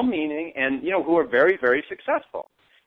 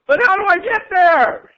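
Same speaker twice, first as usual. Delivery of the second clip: much higher and louder desperate mock yell; frustrated yelling; 13 semitones higher, 7 dB louder than usual. Person stuck in lack pleading to the gurus, high frustrated yell. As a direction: shout across the chasm, pleading. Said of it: "if I'm yelling too loud".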